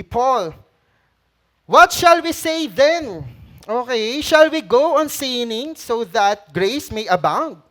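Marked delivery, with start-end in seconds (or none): 1.6-7.6 loud, slow scripture read; booming Bible reading; preaching. Acted. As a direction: proclaim it to the congregation. Preaching Paul's question aloud.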